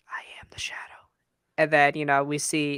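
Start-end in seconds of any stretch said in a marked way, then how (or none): 0.1-0.8 monologue whisper. Retold or imitated. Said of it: "his first monologue when he's like".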